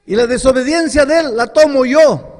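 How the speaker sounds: loud, high declaration; Jesus voiced in a ringing preacher's cry; fervent proclamation. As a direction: throw the line out with full force.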